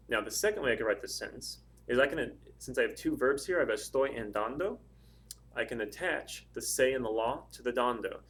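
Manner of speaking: ordinary talk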